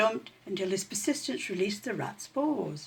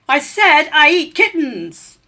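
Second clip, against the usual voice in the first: loud, slow, high shouted threat; indignant rat shout; 7 semitones higher, 14 dB louder, slower than usual. A narrator's reading. Rat shouting at the dozing kitten. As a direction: yell it, furious at being ignored.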